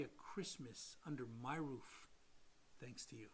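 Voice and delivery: soft, low, moved thanks; sincere and hushed, sinking to near silence; retold heartfelt gratitude. Client thanking the lender for his life back, soft and moved.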